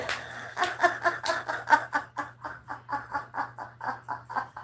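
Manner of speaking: long airy giggling laugh; helpless breathy laughter; breathless giggle. Real, not acted. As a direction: let a silent wheezing laugh run out. Laughing at troll story.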